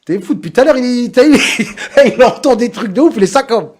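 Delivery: laughing loudly, high and loud. Real, not acted. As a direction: tell it while cracking up. Laughing about a guest who left. (en riant fort)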